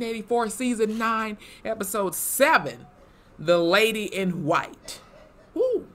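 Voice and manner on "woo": high-pitched whoop of excitement; excited woo. Real, not acted. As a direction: cheer like the episode just dropped. Cheer after episode title.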